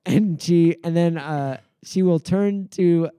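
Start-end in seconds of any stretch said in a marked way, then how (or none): none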